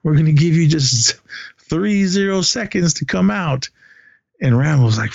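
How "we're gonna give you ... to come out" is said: higher, lightly voiced officer ultimatum; a pompous commander issuing orders, voice raised in pitch; officer on megaphone. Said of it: "And he goes".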